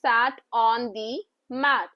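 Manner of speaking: "The cat sat on the mat" read very slowly, word by word; slow model reading. Shown as a demonstration. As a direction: read it slowly for the children to follow. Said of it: "teacher उनको read करके बताते".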